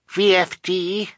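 put-on high, slow toddler guess; high little-girl voice; small child's voice. A narrator's reading. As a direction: spell it out like a tiny child asking. Narrator voices baby Sunny guessing.

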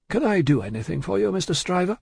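business manner. A narrator's reading. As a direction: offer service with formal courtesy. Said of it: "in his business character".

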